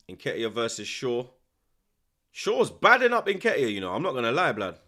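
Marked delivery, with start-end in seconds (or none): 2.3-3.7 excited reaction. Real, not acted.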